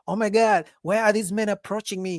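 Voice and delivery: mock indignant video rant; TikTok complainer. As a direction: imitate a woman complaining to her followers. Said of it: "those videos on TikTok".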